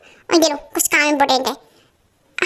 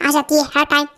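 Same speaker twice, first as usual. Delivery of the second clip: usual speaking voice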